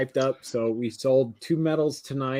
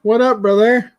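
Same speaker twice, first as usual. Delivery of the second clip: excited greeting; 9 semitones higher, 10 dB louder than usual. Real, not acted. Guest greets host loudly.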